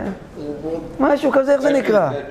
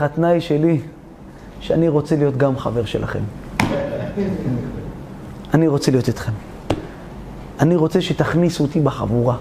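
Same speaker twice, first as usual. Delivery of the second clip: much lower, measured royal voice; king's voice; 8 semitones lower, 3 dB quieter, faster than usual. Acted. King releasing the two friends, in a low regal voice. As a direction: speak down low like a grave king. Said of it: "אמר להם המלך".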